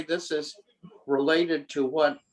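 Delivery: usual speaking voice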